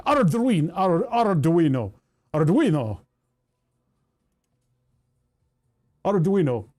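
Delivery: exaggerated Arduino with a rolled R; loud, foreign-style "Arduino", R tapped. Shown as a demonstration. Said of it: "roll my R's when I say it".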